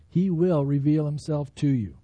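ordinary delivery

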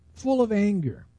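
ordinary talk

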